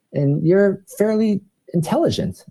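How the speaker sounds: a hint of a curious woman coworker; imitating a coworker.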